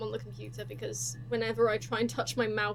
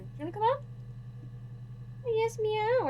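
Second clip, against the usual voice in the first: pet talk; 10 semitones higher than usual. Real, not acted. Streamer coaxing her cat onto her lap, high baby talk. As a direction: coax him up sweetly, much higher.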